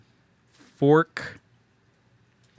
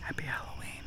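soft whisper, no voice; hushed, playful whisper; whispered greeting; 4 semitones higher, 12 dB quieter than usual. Pretend. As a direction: whisper it like a secret holiday wish. Hushed holiday greeting to co-host.

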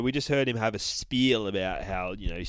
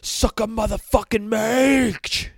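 much higher, louder and slow war cry; battle scream; 13 semitones higher, 9 dB louder, slower than usual. Acted. Bear screaming a battle cry before attacking, loud. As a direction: scream it as you charge. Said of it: "he screams".

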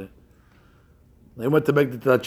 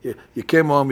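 ordinary talk

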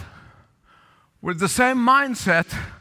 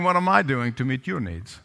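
much lower, even-toned question; selfless question; 9 semitones lower than usual. Retold or imitated. Spouse asking what the other needs, low and unassuming. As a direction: ask it low, without the demand. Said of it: "Can you see the difference?".

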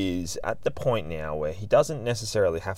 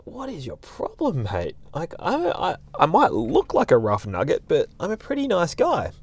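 high, bemused retort to a glaring stranger; a lightly acted what's-your-problem shrug; mock indignant; 8 semitones higher than usual. Speaker retorts to the glaring dog owner, higher and bemused.

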